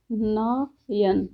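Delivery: नयन stretched very slowly, syllable by syllable; drawn-out pronunciation. Shown as a demonstration. Teacher sounding out the example word for students, very slow. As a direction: pull नयन out slowly so each sound is heard. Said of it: "ये कौन सी संधि है".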